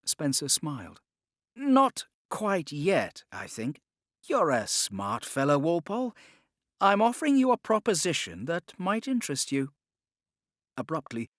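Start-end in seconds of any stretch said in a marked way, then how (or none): 1.5-9.7 smooth, higher-pitched Templar gentleman, unhurried; a calm schemer bargaining for his life; louder, higher voice of a cornered but confident Templar; Spencer's voice. Acted. Spencer's character voice.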